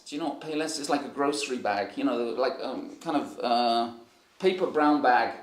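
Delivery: usual speaking voice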